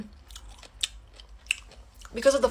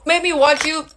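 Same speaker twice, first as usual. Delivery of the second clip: higher, fully voiced reproach; aggrieved accusation; 4 semitones higher, 3 dB louder than usual. Woman repeats her accusation to ex, raised aggrieved voice. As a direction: throw it out high and accusing.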